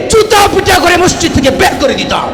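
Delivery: high-pitched, fiery threat from the pulpit; angry preaching. Real, not acted. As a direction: thunder it out high at the crowd.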